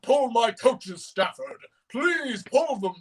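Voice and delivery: slow, drawn-out character voice, hammed up; Gordon. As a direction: gush and plead theatrically, stretching the words. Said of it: "episode about Gordon, and he's like".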